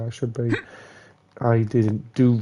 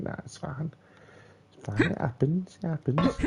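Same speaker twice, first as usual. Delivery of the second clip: mock dismissive; 5 semitones higher, 5 dB quieter than usual. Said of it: "if any of you think".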